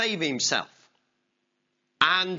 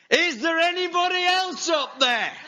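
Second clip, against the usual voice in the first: high, loud desperate yell; 13 semitones higher, 6 dB louder than usual. Acted. Tourist rejects God's answer, loud desperate yell upward. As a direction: shout up for someone else. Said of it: "the tourist yelled up".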